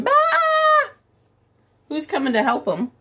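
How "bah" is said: very high bleating sheep cry. Pretend. Teacher voicing the sheep calling for help, high bleat. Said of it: "They're probably going".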